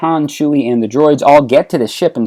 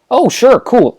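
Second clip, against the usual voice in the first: eager agreement; 11 semitones higher, 5 dB louder than usual. Acted. Luke agrees to road trip.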